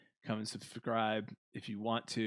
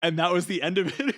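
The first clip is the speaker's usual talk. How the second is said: usual speaking voice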